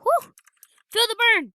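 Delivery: high, loud hammed-up gym cheer; mock workout cheer. Gamer hyping himself up like a workout coach. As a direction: pump yourself up like a trainer.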